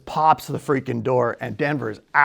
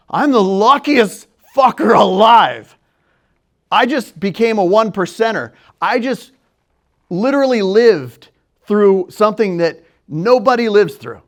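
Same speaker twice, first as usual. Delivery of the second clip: loud, high, emphatic exclamation; reliving a rush of amazed euphoria; manic elation; 6 semitones higher, 9 dB louder than usual. Retold or imitated. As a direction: exclaim it like you just cheated death. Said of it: "massive manic state".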